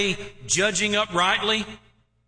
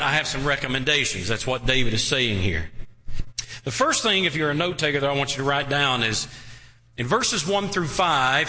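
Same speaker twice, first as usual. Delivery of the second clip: usual speaking voice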